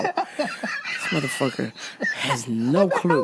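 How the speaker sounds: slow, strangled, laughing groan of disbelief; weird strangled noise. Retold or imitated. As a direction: drag the words out in a choked croak. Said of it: "You never made that noise".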